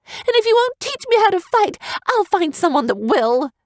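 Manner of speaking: put-on high, defiant girl's shout; angry. A narrator's reading. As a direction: throw the threat at him. Angry daughter defies her father.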